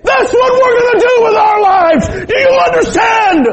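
drawn-out preacher's cry of a dad's vow; retold shout. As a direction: bellow it like a father laying down the law. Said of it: "he's spitting and stomping and crying".

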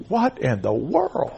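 slow, soft disbelief; quiet, drawn-out astonishment; hushed bewilderment. Retold or imitated. His startled reaction in the story.

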